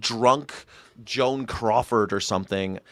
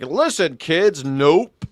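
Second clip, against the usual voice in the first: mock scolding; 6 semitones higher, slower than usual. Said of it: "I'd be like".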